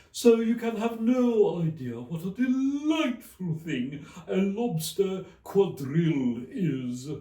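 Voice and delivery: high, loud, mournful Mock Turtle. A narrator's reading. The Mock Turtle quizzes Alice, high and mournful. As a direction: stretch it out like a weepy old turtle.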